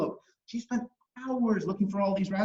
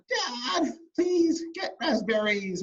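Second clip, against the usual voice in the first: high, drawn-out plea to Dad; acted childish begging; pleading little girl; 5 semitones higher, much slower than usual. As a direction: beg like a kid who really wants it.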